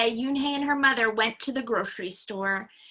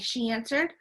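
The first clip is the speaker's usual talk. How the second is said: ordinary delivery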